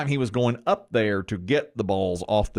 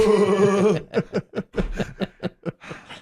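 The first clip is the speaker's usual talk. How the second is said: laughing; 8 semitones higher than usual. Real, not acted. Laughing at own joke.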